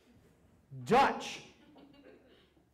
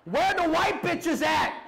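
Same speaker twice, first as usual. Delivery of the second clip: much higher, louder, slow yell; shouted shirt quote; 11 semitones higher, 6 dB louder, slower than usual. Retold or imitated. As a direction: bellow it like a street preacher. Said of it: "weirdly attributed quotes".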